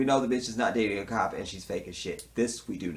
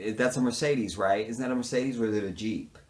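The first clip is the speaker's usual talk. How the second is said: ordinary talk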